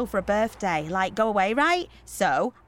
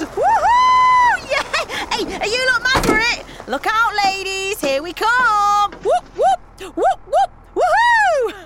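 very high, loud party cheer; rowdy whooping; 11 semitones higher, 8 dB louder, slower than usual. Acted. Organiser hyping up the mums at the bus, wildly excited. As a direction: holler and whoop at the whole crowd.

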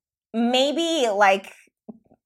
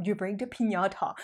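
Mexican accent; 9 dB quieter than usual. Shown as a demonstration. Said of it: "So with a Mexican accent".

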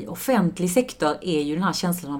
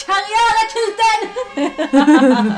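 very high, loud, drawn-out scream; full-out scream; 7 dB louder, much slower than usual. Pretend. Co-host told her to just scream, full playful scream. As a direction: let rip and scream the show title. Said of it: "bara skrik".

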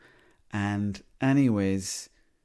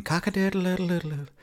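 rooster crow; 10 semitones higher than usual. Retold or imitated. Man imitates his rooster at half seven, high crowing. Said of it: "he was cock-a-doodle-doodling in the garden".

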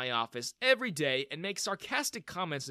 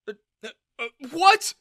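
stammering shock; 11 semitones higher, 12 dB louder than usual. A narrator's reading.

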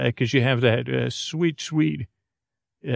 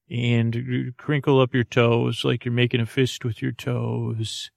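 guided meditation. Acted. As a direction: ease them gently into relaxing.

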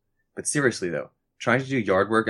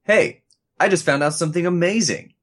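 high, eager announcement; bursting to share news; excited; 7 semitones higher than usual. Acted. Acted phone call, excited news.

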